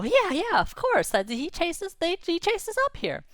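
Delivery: high, squeaky little fish chatter; squeaky worried anchovies; tiny anchovy voice. Acted. Anchovies answer the narrator.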